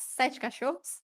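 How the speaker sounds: ordinary delivery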